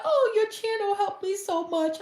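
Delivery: high, squealy fan voice; gushing fan. Pretend. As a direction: squeal your thanks like a devoted follower. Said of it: "they're like".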